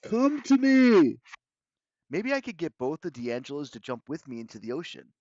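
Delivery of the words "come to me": loud, high, drawn-out plea; desperate call. A narrator's reading. Hero summoning Grover to the cliff, desperate shout. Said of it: "I thought desperately".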